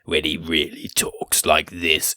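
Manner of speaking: low, slow, sinister Sidious impression. Podcast host imitates Palpatine's Sidious voice, low and slow. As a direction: drop into a low slow villain drawl. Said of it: "actual Sidious voice right at the end".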